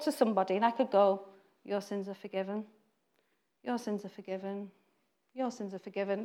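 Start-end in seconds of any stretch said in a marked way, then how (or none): 1.6-6.2 flat empty words. Shown as a demonstration. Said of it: "They're just words".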